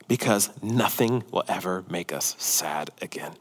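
tender hushed reassurance; whisper. Real, not acted. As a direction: breathe it out gently. Preacher drops to a whisper.

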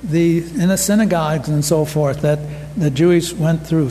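ordinary talk

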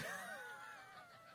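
high sudden laugh; short bright laugh; burst of laughter. Real, not acted. Laugh after joke about Sonia.

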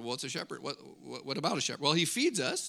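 ordinary delivery

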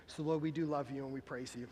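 hushed pastoral prayer over soft music; closing prayer. Acted. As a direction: pray it quietly over the congregation.

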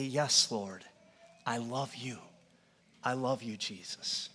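hushed, heartfelt words to Jesus; tender prayer. Acted. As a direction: speak it like a quiet confession of love.

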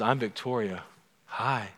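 soft, lightly acted woman's greeting; a hint of a character voice saying hello; Victoria. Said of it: "That's my best Victoria Hi voice".

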